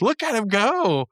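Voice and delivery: mock amazed cartoon voice; high excited quote. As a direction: marvel at him flying off. Quoting characters gleefully.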